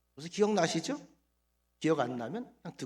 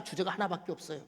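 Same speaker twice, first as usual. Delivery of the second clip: normal talking voice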